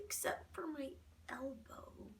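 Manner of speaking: slow guilty whisper; sheepish whisper. A narrator's reading. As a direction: admit it in a hush.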